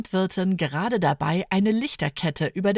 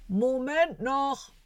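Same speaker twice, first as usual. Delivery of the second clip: langsam, gedämpft wie von oben aus dem Dachboden; dumpfe Stimme; 6 semitones higher, 3 dB quieter, slower than usual. A narrator's reading. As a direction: klingt fern und dumpf hinter der Decke. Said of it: "hören sie Papas dumpfe Stimme". (Papa ruft gedämpft vom Dachboden)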